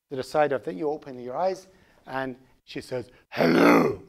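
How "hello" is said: deep, loud action-hero greeting; low booming impression of a muscleman's voice; Arnold Schwarzenegger. Six-year-old daughter waking you in a man's voice, Arnold Schwarzenegger impression. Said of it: "With, like, Arnold Schwarzenegger's voice".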